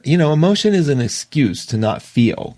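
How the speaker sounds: normal talking voice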